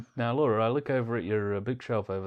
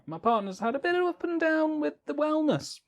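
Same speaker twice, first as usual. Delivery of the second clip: mock delicate. Teasing partner delicately.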